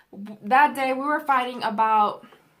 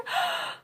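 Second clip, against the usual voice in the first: gasp; 6 dB quieter than usual. Retold or imitated. Shocked at being thrown out.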